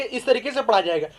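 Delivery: ordinary talk, nothing marked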